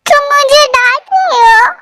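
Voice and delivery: put-on sulking drunk girl complaining to the man; drunken whine. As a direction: pout like a tipsy child being scolded. Drunk girl sulks at scolding.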